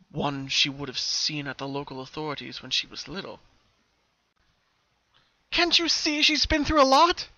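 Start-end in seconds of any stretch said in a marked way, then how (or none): 5.5-7.3 much higher, louder, slow protective plea; a mother defending her child to the police. A narrator's reading. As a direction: shield your daughter from the questions. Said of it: "her mother told".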